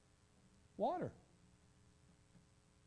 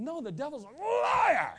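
slow, high shout, stretching liar; preacher shouting to fire up the kids; rallying shout; 11 semitones higher, 10 dB louder, slower than usual. Retold or imitated. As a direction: yell it to whip the kids up. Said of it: "I said".

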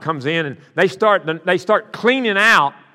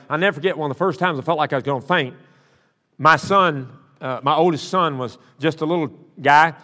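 ordinary talk, nothing marked

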